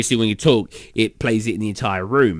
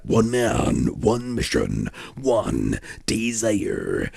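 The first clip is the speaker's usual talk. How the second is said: paid voice-acting voice; 2 semitones lower, 3 dB quieter, much slower than usual. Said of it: "the one that people tend to pay you".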